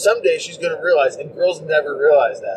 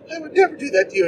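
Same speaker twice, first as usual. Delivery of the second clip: put-on much higher girlish pleading; mock girl voice; 13 semitones higher, faster than usual. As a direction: ham up the lovestruck girl.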